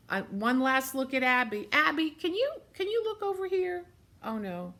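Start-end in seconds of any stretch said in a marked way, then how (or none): 1.7-3.8 coaxing. Real, not acted. Coaxing shy child.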